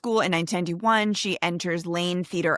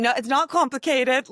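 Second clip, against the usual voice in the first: put-on posh British accent; 5 semitones higher, 3 dB louder than usual. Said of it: "for a posh accent".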